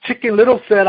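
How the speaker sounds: put-on lively read-aloud to children; storyteller reading a picture book; story reading. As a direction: tell the fable with bright animal voices. Reads Chicken Little aloud.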